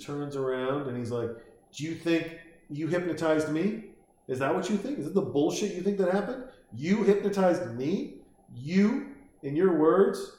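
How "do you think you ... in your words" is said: higher-pitched, incredulous mock questioning; acted challenge. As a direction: throw it back as a rising challenge. Acting out the kid's challenge.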